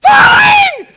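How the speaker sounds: screaming very high and loud, full panic; panicked scream. Retold or imitated. Climber slipping fifty feet up, screaming in panic. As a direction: shriek it as the boots give way. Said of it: "So I holler".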